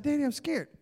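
put-on small worried kid voice; scared little boy. Little boy telling Dad he's scared, timid.

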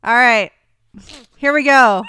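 forcing a loud voice. Real, not acted. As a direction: push the volume up. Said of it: "I really am not a loud person".

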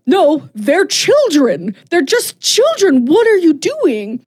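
high, rising, pleading protest; pleading cry. Retold or imitated. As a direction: beg him to stop, voice climbing. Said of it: "And she's like".

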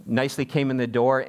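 usual speaking voice

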